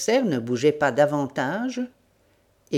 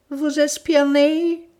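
moan; 11 semitones higher, 5 dB louder, slower than usual. Acted. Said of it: "gémit Wilson".

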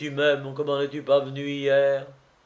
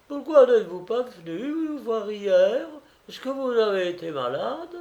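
higher, lightly voiced Praskovia; a fussing girl asking after him; fussing young woman; 10 semitones higher, 5 dB louder than usual. Praskovia greets the suitor on the doorstep, fussing.

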